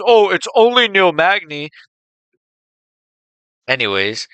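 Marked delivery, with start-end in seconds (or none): 0.0-1.7 high, dismissive mock fan voice. Mocking critics of Prates, whiny high mock voice. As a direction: whine it like a sneering fan.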